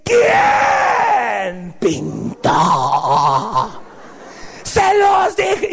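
drawn-out, hammed-up furious scream; mock a mother bellowing, stretching every word; enraged mom. Mom finds the floor painted, enraged shout. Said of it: "mi mamá se enojaba de tal manera".

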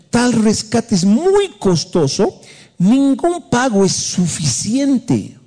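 high, slow, emphatic reading; scripture declamation. Acted. Pastor reading another Bible version aloud, emphatic declamation. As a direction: proclaim the verse with drawn-out words.

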